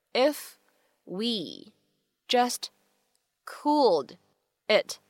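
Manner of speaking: each word isolated with a pause. Shown as a demonstration. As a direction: say it one careful word at a time. Model sentence read word by word.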